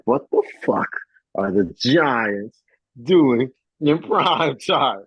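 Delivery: slow, high, exasperated rant; mock outraged yell. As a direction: imitate a host losing it over the schedule. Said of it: "'cause he is spazzing".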